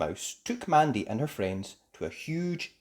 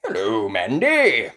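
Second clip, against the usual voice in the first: loud, slow greeting; a hint of old grandpa; lightly voiced Grampy Rabbit; 10 dB louder, slower than usual.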